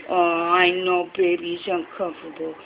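soothing a scared dog. Real, not acted.

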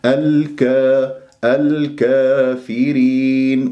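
slow, drawn-out الكافرين with full aa; measured tajweed model of الكافرين; correct open alif. Shown as a demonstration. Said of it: "لا أميل الألفات".

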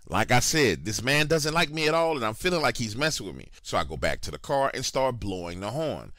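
usual speaking voice

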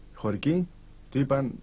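ordinary delivery

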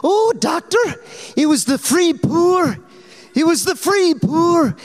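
very high, pleading Scottish woman; Scottish lilt, high and insistent; imitating Ruth's Scottish to the doctor; Scottish accent. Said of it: "she turned on her full Scottish for this doctor".

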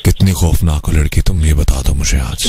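low, teasingly ominous question; deep playful interrogation; mock spooky. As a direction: drop the pitch way down, mock-menacing. Host teases with mock menace.

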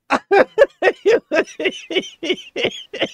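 a long high burst of real laughter. Real, not acted. Gamer to viewers, losing it at the replay, high laugh. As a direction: let the laugh take over, high.

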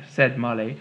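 plain reading voice

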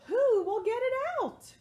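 high-pitched plea for help; anxious story question. Acted. Animals asking about the lost ball, high-pitched worry. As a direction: ask it high, falling at the end.

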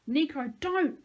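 loud, high plea; screamed. A narrator's reading. Narrator begs Nico to stop firing, screamed. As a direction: cry out to stop him. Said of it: "I screamed".

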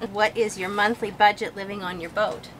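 ordinary talk, nothing marked

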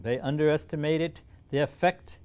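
usual speaking voice

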